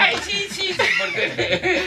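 very high squealing laugh. Real, not acted. Host giving in to the correction, squealing laugh.

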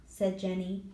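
plain reading voice

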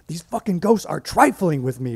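high, loud panicked screech. Retold or imitated. Nancy screeching at her coworker over the phone, high and loud. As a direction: shriek it down the phone. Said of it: "she screeched into the phone".

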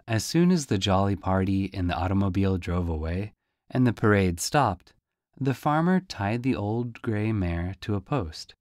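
usual speaking voice

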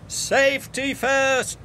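high, drawn-out cry. A narrator's reading. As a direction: call it out high and slow. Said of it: "cried Ned and Oliver".

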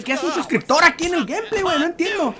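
high-pitched, bewildered protest; baffled disbelief. Real, not acted. Gamer puzzled by a subscriber inside his gameplay, baffled disbelief. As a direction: ask it in high, exasperated confusion.

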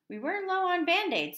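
nurse character. A narrator's reading. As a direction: pipe up as the nurse. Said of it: "said the nurse".

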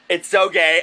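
ordinary talk

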